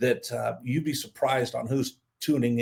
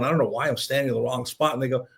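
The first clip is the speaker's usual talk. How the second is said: usual speaking voice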